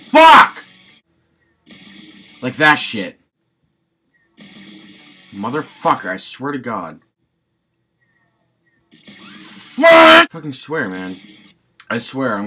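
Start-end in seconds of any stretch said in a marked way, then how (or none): 0.1-0.6 screamed curse, high and loud; raging gamer. Real, not acted. 9.2-10.3 screamed curse, high and loud; raging gamer. Real, not acted.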